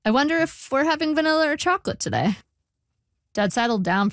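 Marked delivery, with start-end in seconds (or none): 0.1-2.4 high-pitched airy wondering; breezy diversion. A narrator's reading. Narrator diverting dad with talk of ice cream, breezy. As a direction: lift it high to change the subject.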